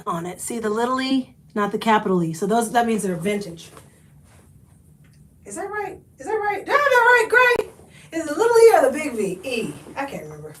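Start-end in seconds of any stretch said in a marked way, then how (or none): 5.5-8.2 calling out. Real, not acted. Calling off-camera to Gray.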